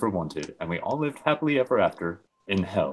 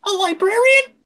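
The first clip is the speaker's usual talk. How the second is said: tiny squeaked question, very high; 7 dB louder than usual. Acted. Narrator squeaking at the surprise of a librarian. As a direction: squeak it out like a mouse. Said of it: "I squeaked out".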